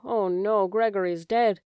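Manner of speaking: plain reading voice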